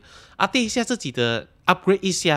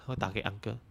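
normal talking voice

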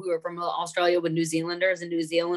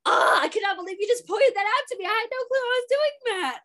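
very high, hammed-up embarrassed protest; mock disbelief. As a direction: squeal it like an embarrassed friend caught out. Acting out friend's embarrassed disbelief.

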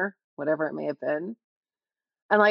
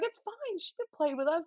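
put-on high, soft child's voice smoothing things over; calm them down like a small girl; peacemaking kid; 11 semitones higher, 4 dB quieter than usual. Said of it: "I'd be like".

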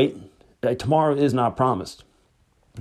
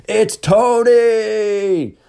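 drawn-out, high, loud cheer; slow joyful shout of a name; excited shout; 11 semitones higher, 5 dB louder, much slower than usual. Retold or imitated. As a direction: shout it with arms flung up. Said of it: "threw his hands in the air and shouted".

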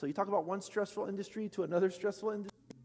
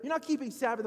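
higher, louder, hammed-up accusation; mock accusing; 8 semitones higher, 6 dB louder, faster than usual. Pastor mimicking his church scolding beachgoers, mock accusing. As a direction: play the judgy churchgoer, half joking.

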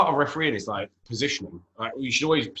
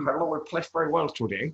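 comic put-on Dutch accent; Steve McLaren; 3 semitones higher than usual. Co-host mimicking a post-match interview, mock Dutch accent. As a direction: imitate an Englishman slipping into Dutch-accented English. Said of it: "Steve McLaren did it in Holland".

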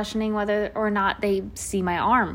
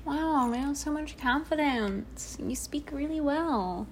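imagined admirer voice; 5 semitones higher, 6 dB quieter, slower than usual. Pretend. Mock praise from imagined stranger.

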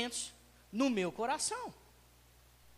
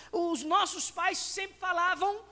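normal talking voice

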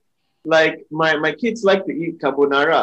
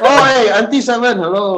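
laughing greeting; 7 semitones higher, 6 dB louder than usual. Retold or imitated.

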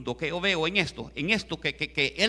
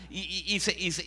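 ordinary delivery

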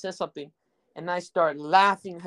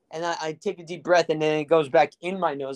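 ordinary delivery

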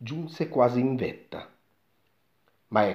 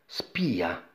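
plain reading voice

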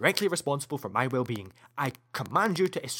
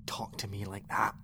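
a strained, much softer whisper; whispered plea; 6 semitones lower, 7 dB quieter than usual. Acted. [name] whispering at the AI to stop. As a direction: beg it to stop without the voice.

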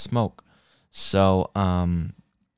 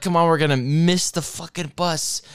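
impatient urging; 7 semitones higher, 4 dB louder than usual. Retold or imitated. Urging slow friends to hurry.

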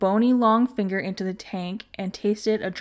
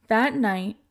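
usual speaking voice

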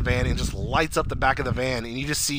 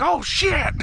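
high-pitched retold exclamation; mock alarm. Imitating him as the shooting starts, high alarmed cry. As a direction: blurt it high, as if caught in gunfire.

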